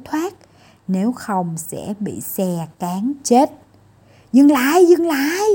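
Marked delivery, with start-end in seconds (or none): 4.3-5.5 higher, louder urgent call; shouting stop. Acted. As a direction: cry out to halt the runaway car. Crowd calling to stop car.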